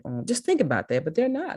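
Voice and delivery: normal talking voice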